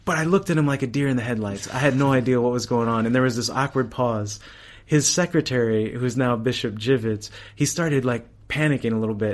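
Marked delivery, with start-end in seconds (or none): none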